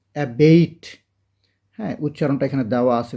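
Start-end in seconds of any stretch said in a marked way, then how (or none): 0.1-0.9 অ্যাবেট said clearly as "ebeit", rising at the end; pronunciation demo. Shown as a demonstration. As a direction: stress the first syllable of অ্যাবেট, say ebeit. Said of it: "এটার উচ্চারণটাও কিন্তু দেখে নেবে". (অ্যাবেট উচ্চারণ করে দেখানো)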